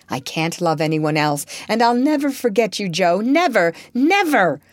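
stamp down on every never; passionate emphasis on never. Acted. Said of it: "with a stamp to emphasize his passionate words".

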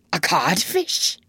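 slow, whispered, stunned question; blank echo. A narrator's reading. Hook repeats the insult to himself, blankly. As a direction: echo it under the breath, rising in disbelief. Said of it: "Hook echoed blankly".

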